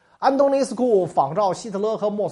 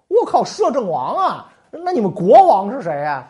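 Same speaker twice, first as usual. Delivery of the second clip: shocked disbelief; 5 semitones higher, 8 dB louder than usual. Retold or imitated. Secretary hears regent title.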